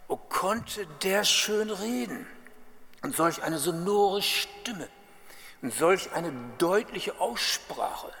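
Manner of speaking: gushing admiration. Retold or imitated. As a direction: gush slowly like a smitten fan. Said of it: "so begeistert".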